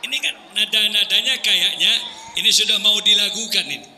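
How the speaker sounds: ordinary talk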